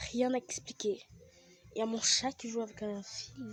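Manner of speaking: ordinary talk, nothing marked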